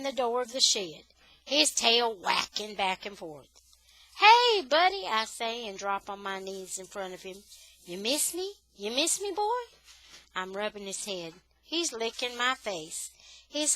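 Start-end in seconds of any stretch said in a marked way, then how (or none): 4.2-5.1 high, loud happy hello to the dog; light up at seeing him; excited greeting. Acted. Boy greeting his beloved dog, high and loud. 7.8-8.5 fond dog talk. Acted. Fussing over his glad dog. 8.8-9.6 fond dog talk. Acted. Fussing over his glad dog.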